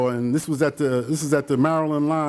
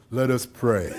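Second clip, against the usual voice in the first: solemn priest; 4 semitones lower, slower than usual. Retold or imitated. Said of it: "he comes up and says".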